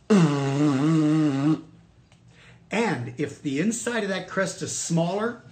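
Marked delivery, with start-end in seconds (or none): 0.1-1.6 loud, droning buzz through a tube; crested dinosaur noise. Shown as a demonstration. Teacher imitating a crested dinosaur, loud tube honk. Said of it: "But if I do have a crest".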